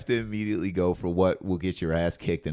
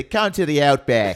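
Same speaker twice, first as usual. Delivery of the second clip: loud, raised mock Australian accent; 5 semitones higher, 9 dB louder than usual. Guest offers his own stock phrase, mock Australian accent. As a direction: call it out in a put-on Australian accent. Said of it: "give me an Australian accent".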